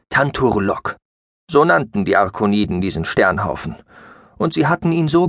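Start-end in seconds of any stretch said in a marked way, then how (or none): none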